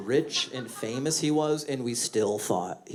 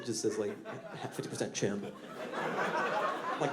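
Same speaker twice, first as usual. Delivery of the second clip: normal talking voice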